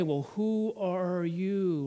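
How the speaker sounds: slow, drawn-out witch's question; sly witch. Retold or imitated. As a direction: stretch the words out like a scheming witch.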